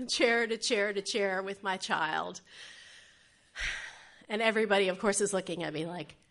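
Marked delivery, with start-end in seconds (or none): none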